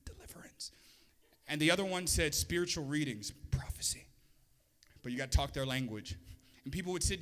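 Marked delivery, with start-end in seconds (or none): 0.1-0.7 hushed whisper, all breath; whispered aside. Acted. Preacher translating a term for the church, whispered aside. 3.5-4.1 hushed, voiceless whisper; whispered aside. Acted. Preacher translating readings for the church, whispered aside.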